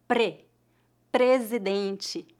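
"Pre" with a tapped R, then "presidente" very slowly; slow model word. Shown as a demonstration. Teacher to students showing the tapped R, slowly. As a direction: isolate the tapped "Pre" before the full "presidente". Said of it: "vibração da ponta da língua no céu da boca".

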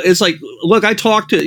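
ordinary talk

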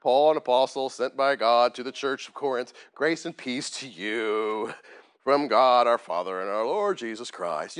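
slow, low singsong drone; mock boring recitation. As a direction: parody a verse read as empty formula. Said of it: "it sounds like blah".

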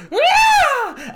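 high shrill-pitched mock scream, loud; girl's scream. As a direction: let out a sudden fangirl shriek. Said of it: "a girl who just goes".

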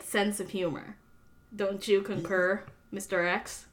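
ordinary delivery

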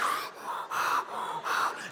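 re-enacted gulps of air, without voice; desperate breathing. Man leaning to the window for clean air, desperate breathing. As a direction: breathe as if escaping a stench.

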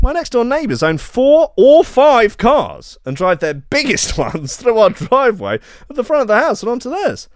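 high-pitched, put-on outraged reading; hammed-up high voice of a wronged homeowner; aggrieved complainant. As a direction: read the complaint like an indignant neighbour. Reads out neighbour's complaint post.

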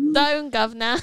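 put-on cheeky Cockney accent. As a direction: play a mock Cockney calling someone governor.

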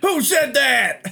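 high, loud, drawn-out yell; mock shout. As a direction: holler it slowly in comic outrage. Movie quote shouted as a joke.

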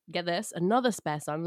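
normal talking voice

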